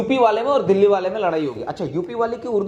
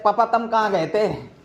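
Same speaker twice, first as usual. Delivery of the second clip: Meerut dialect; 2 semitones higher, slower than usual. Retold or imitated. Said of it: "और मेरठ में क्या बोलते हैं?".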